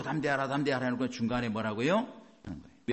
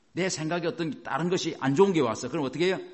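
ordinary talk, nothing marked

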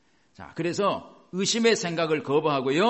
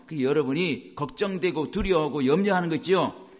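ordinary talk

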